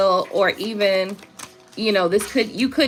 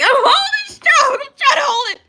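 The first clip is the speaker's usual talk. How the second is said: high strained voice; 6 dB louder than usual. Pretend. Laughing, mock-straining high voice.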